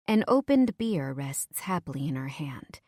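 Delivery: ordinary delivery, nothing marked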